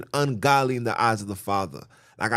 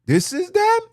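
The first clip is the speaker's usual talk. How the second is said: much higher, louder mock squeal; 7 dB louder than usual. Real, not acted. Preacher mocking boastful women, high squealing jab. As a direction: squeal it out in mockery.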